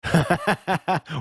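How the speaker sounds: a quick amused laugh; short laugh. Real, not acted. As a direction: laugh at the joke. Host laughs at guest's joke.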